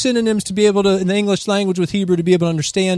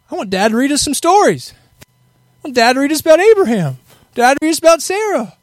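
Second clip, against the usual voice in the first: eager plea; 5 semitones higher, 4 dB louder than usual. Acted. Childlike plea to hear Dad.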